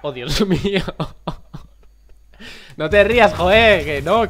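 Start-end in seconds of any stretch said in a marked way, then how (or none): 2.8-4.3 loud defensive protest; loud insistent protest. Real, not acted. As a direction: cut off the laughter loudly.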